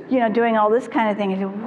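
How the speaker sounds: usual speaking voice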